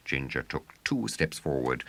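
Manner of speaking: ordinary delivery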